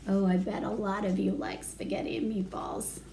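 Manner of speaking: usual speaking voice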